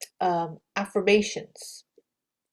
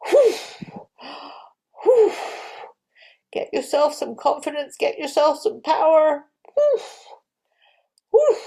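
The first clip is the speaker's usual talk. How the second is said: higher, louder energized puffs of breath; power breath; 6 semitones higher, 3 dB louder than usual. Shown as a demonstration. Teacher showing kids an energizing confidence breath. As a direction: pump yourself up with strong exhales. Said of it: "This is you getting power".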